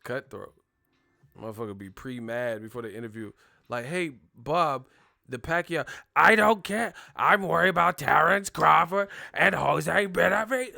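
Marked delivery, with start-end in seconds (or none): 3.9-10.8 loud, much higher irritated rant; pre-mad Bob Arum. Retold or imitated. Bob Arum brushing off a Pacquiao question, hammed-up mad. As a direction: snap at the interviewer before he asks. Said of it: "you pre-mad than a bitch".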